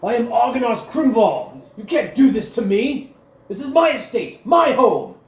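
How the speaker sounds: outraged high, loud yell; captured lord shouting in full force. Acted. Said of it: "he yells out".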